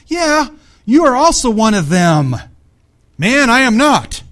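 loud, high, slow and emphatic; heated confrontation. Acted. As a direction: hammer out each word in mock indignation. Acted accusation and Peter's denial.

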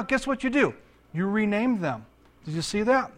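normal talking voice

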